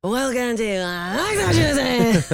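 slow, drawn-out DJ announcement; Nick Kroll DJ voice. Retold or imitated. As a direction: stretch it like a radio DJ hype.

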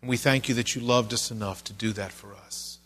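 earnest, measured pastor's prayer; closing prayer. Acted. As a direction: pray it humbly for the congregation.

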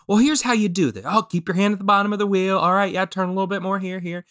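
high, quick running instructions; helpful instructor. Retold or imitated. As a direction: talk a beginner through it step by step. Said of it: "One guy going".

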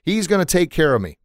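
high-pitched mock yell; acted refusal called up to a rescuer; insistent, mildly hammed-up shout back. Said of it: "the guy yells back".

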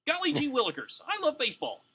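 very high, wholesome gee-whiz voice; Tony Gwynn. Acted. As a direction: pitch it way up like an innocent ballplayer.

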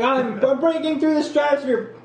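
high squeaky astronaut voice; mock astronaut. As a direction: play a giddy astronaut mid-launch. Mock astronaut launch report.

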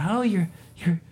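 high, falsely friendly voice; sly lure. Retold or imitated. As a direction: bait him, voice pitched high. Brothers luring Jon out.

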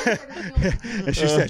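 laughing high while talking. Real, not acted. Telling the story of the big trout, laughing. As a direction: laugh through the words.

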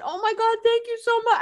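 high, gushing thanks; tearful gratitude. Retold or imitated. Wife thanking husband for tire, high gushing thanks. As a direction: pour out grateful thanks. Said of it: "I'm, like, in tears".

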